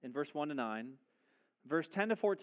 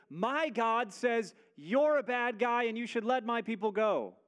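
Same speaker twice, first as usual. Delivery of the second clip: brazen Moses; 6 semitones higher, 5 dB louder than usual. Retold or imitated. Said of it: "just coming into his presence and says".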